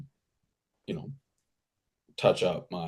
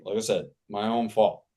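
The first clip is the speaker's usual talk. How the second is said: ordinary talk, nothing marked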